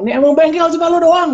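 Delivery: very high-pitched mock rant; exasperated. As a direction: act out an inner outburst of annoyance. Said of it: "jengkel banget gitu". (omelan jengkel dengan nada melengking)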